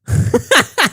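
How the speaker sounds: sharp high laughing burst. Real, not acted. Amused by fans copying him, a high laugh.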